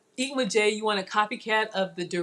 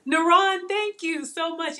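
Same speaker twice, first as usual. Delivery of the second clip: grateful; 8 semitones higher, 3 dB louder, slower than usual. Real, not acted. Thanking a viewer on stream.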